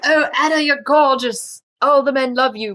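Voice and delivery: gushing friend. Retold or imitated. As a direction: lay the flattery on thick. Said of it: "she's probably like".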